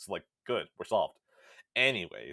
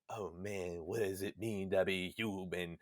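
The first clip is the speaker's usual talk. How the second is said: softer, mock-deep musing; mock pondering; 4 semitones lower, 7 dB quieter, slower than usual. Gamer mocking the game's philosophical theme, softly. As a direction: send up the plot's big question.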